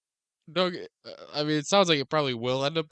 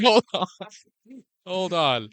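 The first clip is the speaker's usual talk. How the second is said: mock alarmed double take; 7 semitones higher, 8 dB louder, slower than usual. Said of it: "I was like".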